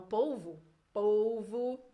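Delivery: polvo, Carioca style, with the final O as a U; Carioca Portuguese. Shown as a demonstration. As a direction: say polvo like a Carioca, with povu at the end. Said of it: "esse O aí do final vira um U". (polvo com sotaque carioca, O final vira U)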